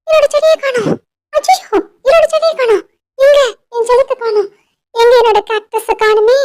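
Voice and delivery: high-pitched, distressed wail over a loss; frantic, high voice reporting something precious missing; panicked. Acted. Lily tells her boss her plant is gone, panicked.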